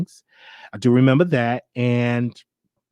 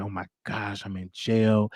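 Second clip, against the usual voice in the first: scared; 3 semitones lower, 8 dB quieter than usual. Retold or imitated. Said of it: "I was so scared".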